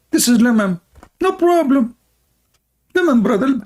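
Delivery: high mocking sing-song of a dismissive believer; sarcastic high impression of an easygoing excuse.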